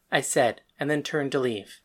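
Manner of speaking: plain reading voice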